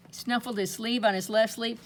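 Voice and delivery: ordinary delivery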